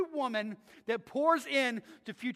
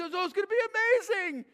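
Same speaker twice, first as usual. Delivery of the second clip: excited; 9 semitones higher, 5 dB louder than usual. Retold or imitated. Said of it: "excited to have a kid and say".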